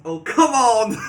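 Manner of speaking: loud, high outburst breaking into laughter; exasperated outburst. Real, not acted. Player reacting to the AI's absurd answer, exasperated and laughing.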